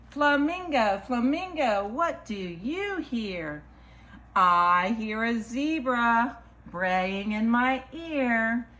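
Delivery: slow, lilting picture-book read; reading to children. Acted. Storyteller reads a picture book to children, lilting. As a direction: read it rhythmically, stretching the key animal words.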